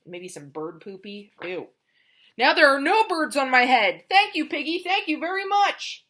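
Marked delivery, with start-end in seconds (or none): 2.4-6.0 higher, louder happy thanks; relieved delight. Acted. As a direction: gush with relief to your friend. Elephant relieved, thanks Piggy.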